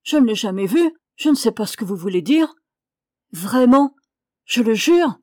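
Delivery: loud, insistent denial; protesting cry. A narrator's reading. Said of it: "cria la jeune fille". (protestation véhémente, voix forte)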